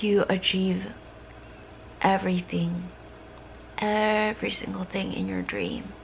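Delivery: soft, mostly unvoiced affirmations; ASMR whisper. To a viewer needing comfort, a soft ASMR whisper. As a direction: whisper close, like comforting a friend.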